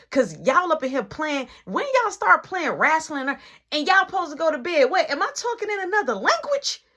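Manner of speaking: imitating a mother's rising scolding, ending very high and loud; acted-out mom losing patience; yelling mom. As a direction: build to an exasperated parental yell. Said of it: "So now she yelling".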